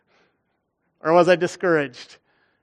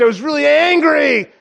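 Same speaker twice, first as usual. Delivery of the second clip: louder, rising to a shout on angry; angry outburst; 7 semitones higher, 8 dB louder than usual. Retold or imitated. Pastor reliving the rejected transplant, shouting his anger. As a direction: let the anger burst out.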